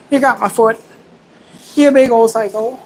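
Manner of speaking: high silly voice for teasing the dog; playful pet scolding. Pretend. Dog playfully attacks his foot.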